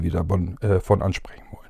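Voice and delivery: hoarse voice failing mid-sentence; voice giving out. Real, not acted. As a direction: voice cracking into a whisper. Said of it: "meine Stimme versagt wieder".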